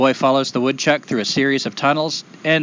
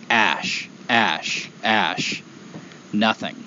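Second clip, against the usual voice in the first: bleak narration; 3 semitones lower, 4 dB quieter, much slower than usual. A narrator's reading. Desolation found in the morning.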